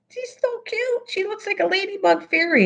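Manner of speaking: very high, cutesy squeal of a voice; cutesy gushing. Real, not acted. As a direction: coo over something adorable. Gushing over a cute fairy button.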